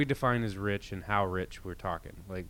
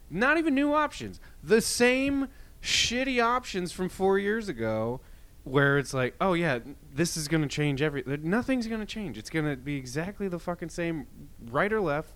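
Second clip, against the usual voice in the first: heated rant; 7 semitones higher, 3 dB louder than usual. Real, not acted. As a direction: voice climbing high in frustration. Ranting about same options.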